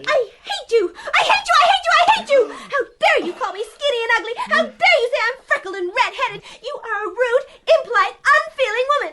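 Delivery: high, angry cries building into a tirade; furious outburst. Acted. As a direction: hurl it at her in outrage. Child rages at insulting neighbour.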